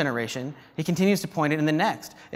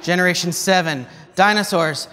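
ordinary talk, nothing marked

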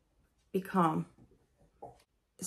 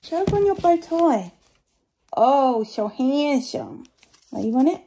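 talking to the dog; 7 semitones higher, 8 dB louder than usual. Real, not acted. Praising her dog's bow tie in high, loud baby talk.

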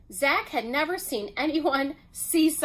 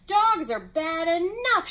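higher, lightly shouted complaint; mild shout; 6 semitones higher, 4 dB louder than usual. A narrator's reading. Mailman facing an alligator, mildly shouting. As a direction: protest with a storyteller's contained shout. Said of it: "shouted the mailman".

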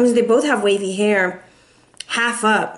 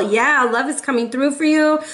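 usual speaking voice

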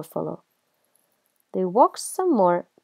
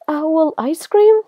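higher, louder, rising in disbelief; alarmed question; 8 semitones higher, 7 dB louder than usual. Acted. Owl hears it is the favorite food, alarmed. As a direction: stretch it slowly into a shocked question.

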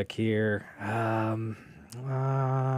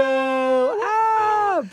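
high loud excited yelp; excited shout; 9 dB louder than usual. Real, not acted. Showing viewers the new studio, excited high yelp. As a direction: let out a thrilled high cry.

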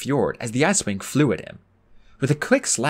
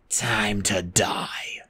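slow, whispered menacing growl; 2 semitones lower, 3 dB quieter, much slower than usual. A narrator's reading. Fjord threatening Clay, whispered growl. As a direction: breathe the threat slowly. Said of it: "Fjord growled".

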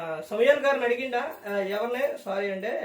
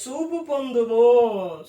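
slow, louder solemn command; commanding Jesus; 5 dB louder, much slower than usual. Retold or imitated. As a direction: pronounce healing slowly. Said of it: "ప్రభువు ఏమని చెప్పిండు చూడండి". (ఆజ్ఞగా నెమ్మదిగా)

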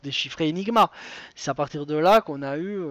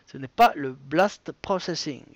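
put-on English accent on Blast Processing; hammed-up English accent; mock English accent; 3 semitones lower than usual. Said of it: "Mon magnifique accent".

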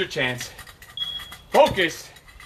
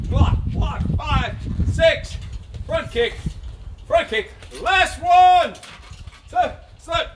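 boxing coach calling combos; 9 semitones higher, much slower than usual. Real, not acted. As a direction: shout the combo to the class. Final round push.

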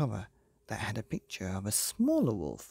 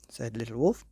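ordinary delivery, nothing marked

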